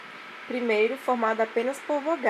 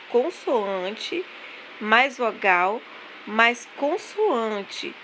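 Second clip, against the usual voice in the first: each part stretched slowly and stressed; slow syllable emphasis; much slower than usual. Shown as a demonstration. Teacher spelling out syllable types for pupils, slow and deliberate. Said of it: "formadas por".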